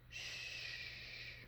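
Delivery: long, unvoiced shushing; whispered hush. A narrator's reading. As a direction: hush everyone very softly. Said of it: "Mama Honey Bear whispered".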